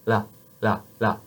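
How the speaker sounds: "la" with the tongue tip on the top teeth; light clear French "la". Shown as a demonstration. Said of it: "but here you do".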